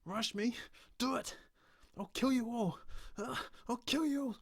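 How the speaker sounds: high, loud unhinged voice; unhinged killer. Acted. Stranger revealing himself as the killer, unhinged. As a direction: snap into the killer's madness.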